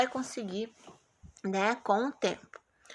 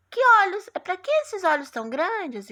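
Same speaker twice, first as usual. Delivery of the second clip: high, louder storybook question; 8 semitones higher, 5 dB louder than usual. Acted. Teacher voicing Chapeuzinho's question in the story, high-pitched asking. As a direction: exclaim it as the astonished girl. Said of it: "a Chapeuzinho Vermelho perguntou".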